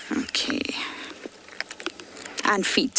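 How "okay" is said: whispered okay. Real, not acted. Hushed aside while watching.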